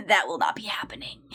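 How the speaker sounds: quick breathy whisper aside. Real, not acted. Confiding to viewers, a fast whisper. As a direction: drop to a secret whisper.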